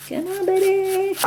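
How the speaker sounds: high, slow coaxing. Real, not acted. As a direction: sweet-talk the onion along. Coaxing a stubborn onion.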